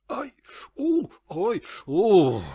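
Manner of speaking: loud high yelps of pain; gritos de dor. Acted. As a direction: cry out at sudden stabs of pain, ending in a loud drawn-out groan. Sudden pain wakes narrator.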